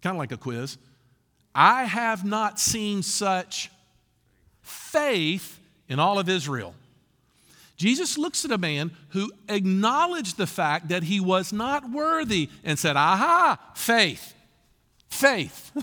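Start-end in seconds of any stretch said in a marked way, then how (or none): 1.5-6.8 slow, weighty quote. Acted. Jesus's words read slowly, emphatic. 13.0-15.7 Aha then faith, faith, louder and higher, in wonder; marvelling exclaim. Acted. Jesus marvelling at the soldier's faith, loud delighted exclaim.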